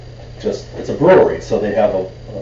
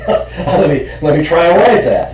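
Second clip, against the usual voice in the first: laughing through it, higher; laughing order; 7 semitones higher, 3 dB louder than usual. Retold or imitated. Ordering the beer named after the waiter, laughing. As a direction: crack up while asking for it.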